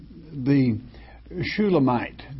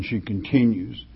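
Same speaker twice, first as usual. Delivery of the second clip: ordinary talk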